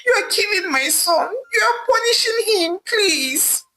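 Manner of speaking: pleading rising to a crying cry; mother begging Ivie, ending in tears; sad pleading. A narrator's reading. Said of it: "pleaded with her sadly".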